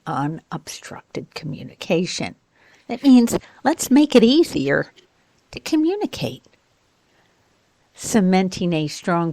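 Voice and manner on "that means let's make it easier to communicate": higher and louder, bright playful restatement; playful paraphrase. Acted. Teacher recasting jargon, higher and louder. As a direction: translate the jargon with a grin.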